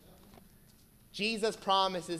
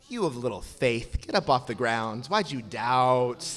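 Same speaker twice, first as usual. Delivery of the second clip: 1 blunt Jesus; 6 semitones lower than usual. Acted. Jesus voiced with hard rebuke.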